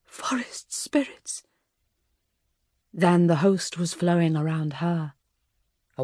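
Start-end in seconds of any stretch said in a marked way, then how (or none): 0.0-1.4 slow, awed whisper. A narrator's reading. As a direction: breathe it out in wonder.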